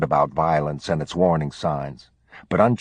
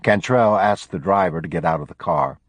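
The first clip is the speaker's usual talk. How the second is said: ordinary delivery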